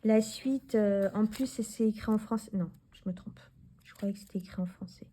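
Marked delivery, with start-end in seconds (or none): 2.5-5.1 whispered to herself. Real, not acted. Muttered self-correction to herself.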